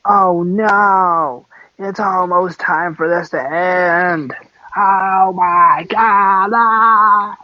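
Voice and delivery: slow, high, drawn-out fake wail; mock crying fans. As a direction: whine it like distraught fans, hammed up. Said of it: "are going to start crying soon".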